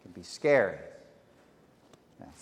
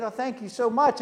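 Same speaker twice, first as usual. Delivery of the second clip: grateful delight; 9 semitones higher than usual. Retold or imitated. Healed man grateful.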